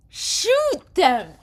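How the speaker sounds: high, loud shout at the screen; excited yell. Real, not acted. Gamer screaming at the game to shoot them.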